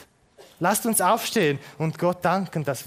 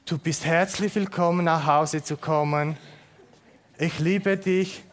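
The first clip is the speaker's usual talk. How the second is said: ordinary talk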